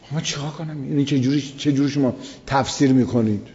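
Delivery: usual speaking voice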